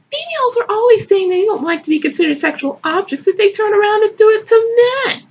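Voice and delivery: fast, high-pitched indignation; worked-up, high-pitched complaint; indignant rant. Acted. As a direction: rattle it off in rising outrage. Ranting over the text.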